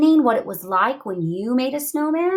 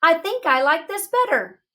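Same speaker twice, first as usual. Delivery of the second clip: high, cheerful mom voice; mom's reply; 10 semitones higher than usual. Acted. Teacher reading the mother's happy reply, high and chirpy. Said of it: "she just smiled and said".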